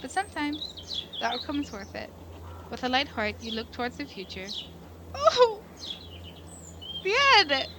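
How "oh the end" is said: high, loud, bright burst; delighted. Real, not acted. Player reaching the game's ending, excited. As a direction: cheer at the finish.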